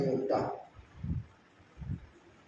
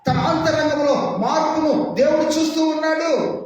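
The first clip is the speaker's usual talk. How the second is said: impassioned preaching; 7 semitones higher than usual. Acted. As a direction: preach it out with full fire. Preaching at full force.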